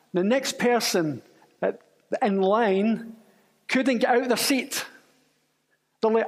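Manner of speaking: normal talking voice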